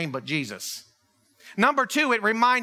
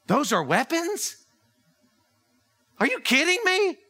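pitched way up, playful incredulity; mock disbelief; 8 semitones higher than usual. Preacher to congregation, pretending gentleness can't be a weapon, mock disbelief. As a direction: act amazed that kindness could be a weapon.

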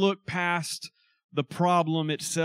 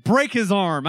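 loud, punchy command; forceful demand; 3 semitones higher, 7 dB louder than usual. Acted. Quoting favorite verse with relish.

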